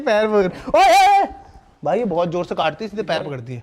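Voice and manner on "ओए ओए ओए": high, loud startled cry; loud yelp. Real, not acted. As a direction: yelp as the dog bites. Dog bites his feet.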